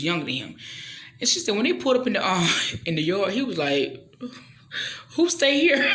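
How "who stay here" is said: high, loud laughing disbelief; shocked husband. Retold or imitated. Husband shocked at run-down house.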